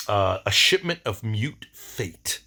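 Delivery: ordinary talk, nothing marked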